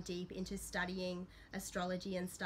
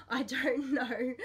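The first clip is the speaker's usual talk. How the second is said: slow, laughing through the words; sheepish laughing I don't know; 4 semitones higher, 6 dB louder, slower than usual. Real, not acted. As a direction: giggle out the admission. Admits not knowing, laughing.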